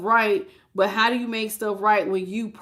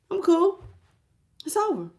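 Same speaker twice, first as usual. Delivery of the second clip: mock breezy indifference; 8 semitones higher than usual. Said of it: "yours is like".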